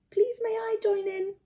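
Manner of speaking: high, timid little rabbit voice; timid rabbit asking; shy bunny. Acted. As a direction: ask shyly, a small voice. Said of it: "asked Lettuce shyly".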